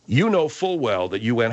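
ordinary talk

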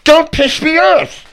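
slow and loud with a lisp; "piss" comes out "pish"; slushy lisp from a missing tooth. Real, not acted. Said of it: "We can't understand a word you're saying".

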